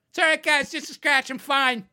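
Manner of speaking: high, loud all-clear to the guys; over-bright shouted reassurance. Retold or imitated. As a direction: call out that all is fine. Announcing to friends he's fine.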